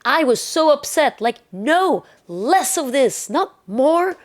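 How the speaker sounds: exasperated. Real, not acted. Upset about replaced character.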